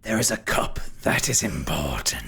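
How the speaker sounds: low, whispery spirit voice; ghostly villain. Acted. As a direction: reveal it in a low, menacing whisper. Game master voices Derethor.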